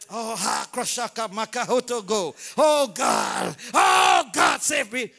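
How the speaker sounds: hammed-up shouting prayer in tongues; frantic tongues prayer. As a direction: shout like a church lost in prayer.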